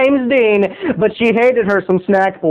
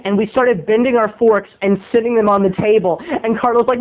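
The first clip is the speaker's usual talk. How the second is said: ordinary talk, nothing marked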